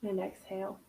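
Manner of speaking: soft, hushed meditation voice; a quiet spiritual breathing guide; guided meditation. Acted. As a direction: guide them into calm, breath by breath.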